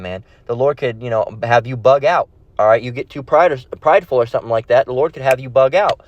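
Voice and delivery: ordinary delivery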